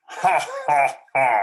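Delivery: loud laugh through cupped hands; cupped-hands villain laugh. Shown as a demonstration. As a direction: make the laugh boom like a megaphone. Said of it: "You can even use your hands like this".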